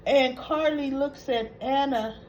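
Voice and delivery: usual speaking voice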